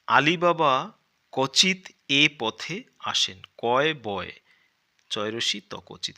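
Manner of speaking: loud, slow storybook reading; reading aloud. Acted. Teacher reading the primer story to children, loud and slow. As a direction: read the passage out grandly for the class.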